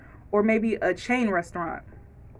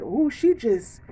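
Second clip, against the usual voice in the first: gossiping onlooker; 3 semitones higher than usual. Retold or imitated. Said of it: "I don't want y'all to be like".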